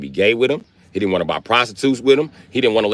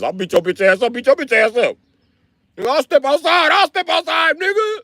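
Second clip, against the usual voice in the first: mock fight threat. Comic voicing a friend calling him out to fight, mock shouted threat. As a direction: yell it like a hothead picking a fight.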